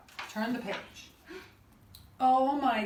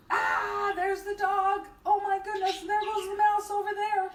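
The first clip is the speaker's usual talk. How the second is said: high-pitched storytime fluster; excited, high exclaiming; mock alarm; 9 semitones higher than usual. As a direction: play up the surprise for children. Animals jump awake, mock alarm.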